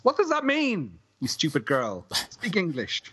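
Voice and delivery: high-pitched comic exasperated rant; mock exasperation. Host railing at an actress's garbled line, mock exasperated.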